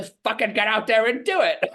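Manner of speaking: a high, laughing attempt at a Scottish accent; mock Scottish swearing, laughing through it. Retelling the actor's Scottish pep talk to the sales team, mock accent. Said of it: "in his very Scottish accent".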